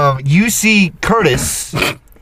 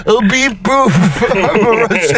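higher-pitched mock Russian robot; 4 semitones higher than usual. Shown as a demonstration. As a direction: beep like a silly Russian robot. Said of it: "wait, I got it".

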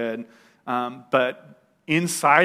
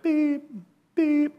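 slow, very high, evenly spaced mock monitor beeps; heart monitor beep; 14 semitones higher than usual. Recovering addict mimicking the hospital heart monitor, slow high beeps. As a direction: imitate a calm heart monitor, one beep at a time. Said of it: "it's like".

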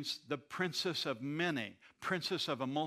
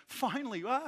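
higher-pitched burst of overjoyed relief; rejoicing; 9 semitones higher than usual. Acted. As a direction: exclaim it as if rejoicing at long-awaited news. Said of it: "He's really rejoicing".

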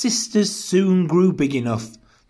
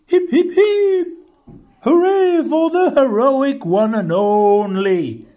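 very high, loud cheer; high hooting cheer, easing down; happy hooting; 8 semitones higher, 7 dB louder than usual. A narrator's reading. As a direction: hoot a jubilant hurrah. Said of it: "the duckbills hooted happily".

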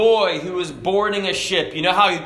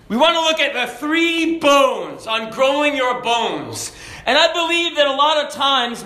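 raised-pitch, driving pulpit delivery; preaching; 7 semitones higher than usual. Real, not acted. Preacher urging congregation that God gives strong bones, preaching. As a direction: preach it high and insistent, drawing out the last phrase.

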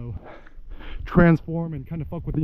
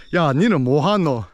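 Gyeongsangdo dialect of Busan; Busan Saturi; much faster than usual. Shown as a demonstration. Contrasting hometown speech with Seoul, Busan Saturi. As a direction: say it in gangster-film Busan dialect. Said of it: "Busan Saturi".

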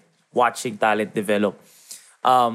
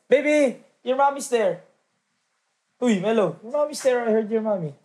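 put-on higher, singsong teasing of a mama's boy; mock teasing baby talk; 10 semitones higher than usual. Teammates mocking the rookie whose mom showed up, in a high teasing singsong.

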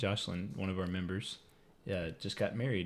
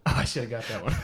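laughing; 3 semitones higher, 8 dB louder than usual. Real, not acted. Caught giving wrong answer, laughing.

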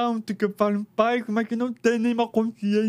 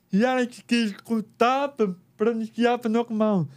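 normal talking voice

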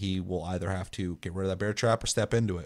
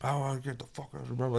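imitated distant, muffled chatter, much softer; out of proximity; 3 semitones higher, 8 dB quieter than usual. Teammate running off toward the boat, heard faintly out of proximity. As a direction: mimic a voice drifting out of earshot. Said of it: "I can hear him talking out of proximity".

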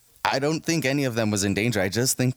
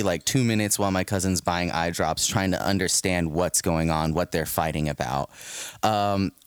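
ordinary delivery

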